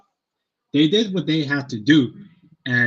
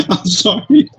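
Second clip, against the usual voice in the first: laughing; 9 semitones higher, 10 dB louder than usual. Real, not acted. Laughing after the joke.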